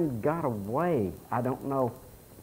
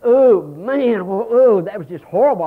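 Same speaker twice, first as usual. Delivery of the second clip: retold dismay; 4 semitones higher, 8 dB louder than usual. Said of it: "I went".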